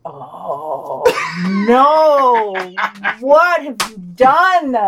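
loud, slow, hammed-up dismay; mock horror. Co-host makes a bad joke, answered with hammed-up horror. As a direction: wail it like a tragedy.

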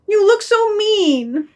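high, slow fearful whine; scared, pleading accusation; frightened dog. Acted. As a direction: draw it out high in fear. Dog fears the master.

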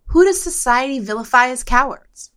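ordinary talk

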